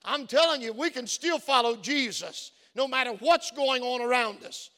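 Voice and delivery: ordinary delivery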